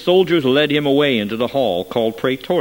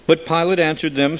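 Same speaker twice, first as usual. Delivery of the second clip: usual speaking voice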